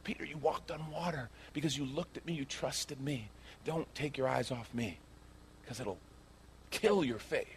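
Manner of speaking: very soft, intimate counsel to Peter; gentle Jesus. Acted. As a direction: coax him tenderly, barely above a murmur. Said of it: "What's he really trying to say?".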